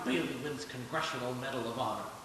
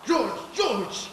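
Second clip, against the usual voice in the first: loud, high panicked calling of a name; frantic call; 8 semitones higher, 6 dB louder than usual. Acted. Uncle bursting in, calling George in alarm.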